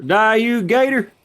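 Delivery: high shout at the gator; battle yell. Real, not acted. Attacking an alligator in game.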